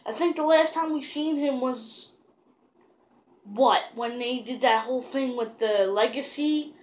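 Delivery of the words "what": usual speaking voice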